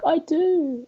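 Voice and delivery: high, thin voice as the sick mum; lightly voiced weary mum; mum's pitiful reply. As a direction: squeak it out like a poorly mother. Mum answering about her headache.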